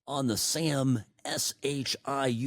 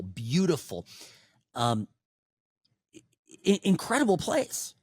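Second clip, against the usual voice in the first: ordinary delivery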